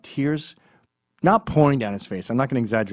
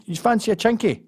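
Scottish offer; 8 semitones higher, 3 dB louder than usual. Retold or imitated. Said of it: "it's just like".

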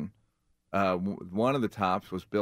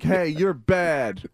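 louder, higher, drawn-out mock telling-off; mock scolding call; 6 semitones higher, 7 dB louder, much slower than usual. Comedian imitating Eddie pretending to phone comics, mock scolding. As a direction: call out a slow, exaggerated put-down.

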